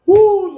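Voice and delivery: high, loud "woo" after laughing; high laughing whoop. Real, not acted. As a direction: let out a whoop to recover from the giggles. Catching breath after laughing fit.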